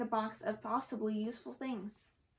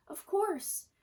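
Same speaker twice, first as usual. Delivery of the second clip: much higher, struck by the idea; sudden realization; 8 semitones higher than usual. A narrator's reading. Girl realizes the cat cannot climb down, surprised. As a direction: gasp as it dawns on you. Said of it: "Gracie gasped".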